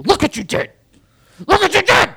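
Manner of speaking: high, loud accusing yell; mock shout. Imagined kid yelling at the dad, holding it up. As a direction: shove it in his face, yelling. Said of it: "Holds it in front of you".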